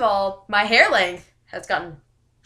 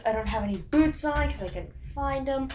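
normal talking voice

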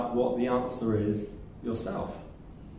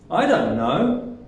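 slow, louder, annoyed with a rising end; 5 semitones higher, 5 dB louder, slower than usual. Shown as a demonstration. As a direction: sound irritated, swooping up on KNOW. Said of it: "say it and sound annoyed".

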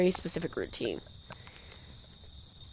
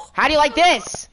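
louder, higher jeer with a falling end; taunting yell; 7 semitones higher, 8 dB louder than usual. Real, not acted. Gamer gloating at a counselor, loud taunting yell. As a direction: rub it in, loudly.

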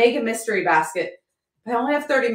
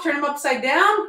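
normal talking voice

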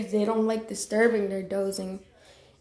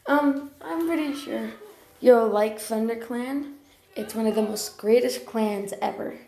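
normal talking voice

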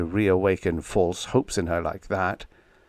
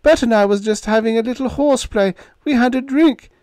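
high, stammering, caught out; flustered lie, voice pitched up high; 7 dB louder than usual. A narrator's reading. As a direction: gobble out a nervous denial. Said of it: "gobbling like a block-bound turkey".

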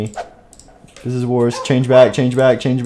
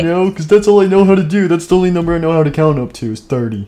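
fast talk; 2 semitones higher, faster than usual. Pretend. Fast joking count announcement.

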